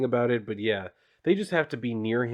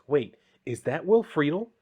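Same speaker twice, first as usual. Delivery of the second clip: normal talking voice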